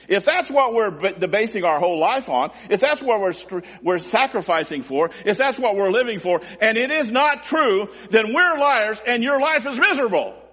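urgent pulpit build, rising to a high cry; full-force preaching. Real, not acted. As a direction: pile up the conditions, then cry out the verdict.

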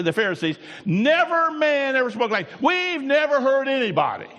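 loud, high-pitched preaching, building in force; impassioned preaching. Acted. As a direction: preach it hard, driving up to a ringing cry.

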